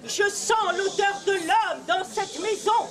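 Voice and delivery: slow, loud ogress growl of suspicion; slow, loud menacing ogress. Acted. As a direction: draw it out like a monster sniffing prey. Ogress sniffing out a man.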